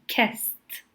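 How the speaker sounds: "kissed" with an audible final T; final T sound. Shown as a demonstration. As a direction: say "kissed" as "kist", T released. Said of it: "So you can hear that T".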